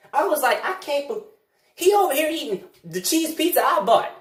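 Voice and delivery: worked-up indignant rant; outraged realization. Acted. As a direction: rant in disbelief. Realizing he was set up.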